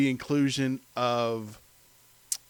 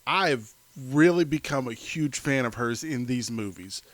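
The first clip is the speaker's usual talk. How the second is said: usual speaking voice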